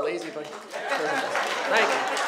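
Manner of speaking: ordinary delivery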